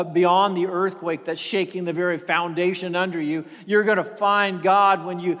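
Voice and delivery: ordinary talk, nothing marked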